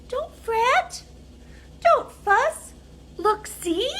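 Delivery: high squeaky mouse voice; high, fussing little mouse. Acted. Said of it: "Mouse squeaks".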